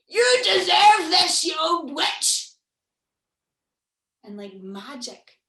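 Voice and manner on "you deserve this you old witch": slow, loud monster yell down the chimney; monster shout. Acted. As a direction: bellow it down at her. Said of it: "shouts down the chimney".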